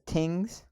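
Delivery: TINGS with a hard T for "th"; island-style tings. Retold or imitated. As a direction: say TINGS with a plain T opening, drawn out. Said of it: "now she says tings".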